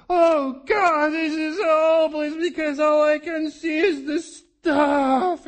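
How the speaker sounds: high, loud, slow whining cry; despairing whine. Retold or imitated. A believer complaining to God, high loud despairing cry. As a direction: moan it out like all hope is gone.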